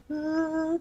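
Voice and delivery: high sobbing sung wail; crying voice. Shown as a demonstration. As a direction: wail a note like you're crying. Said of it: "Crying voice".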